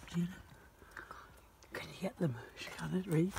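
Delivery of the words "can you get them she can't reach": hushed whisper; whispering while stealing apples. Real, not acted. Sneaking apples at night.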